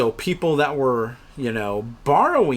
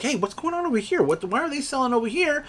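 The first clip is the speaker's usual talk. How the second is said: higher, anxious whisper-close question; worried question to a buddy; 11 semitones higher than usual. Retold or imitated. Trader asking his buddy why they sell, high and worried. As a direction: ask your friend nervously what's happening. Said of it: "ask your buddy over here".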